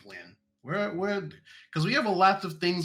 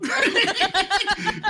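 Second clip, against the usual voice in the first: high burst of laughter; 8 dB louder than usual. Real, not acted. Laughs at GM's refusal.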